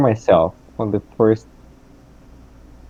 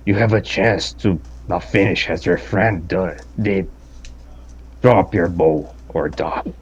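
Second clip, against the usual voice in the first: half dragon voice; 2 semitones lower, 3 dB quieter than usual. Acted. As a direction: threaten like a cornered dragon. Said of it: "and with my draconic voice".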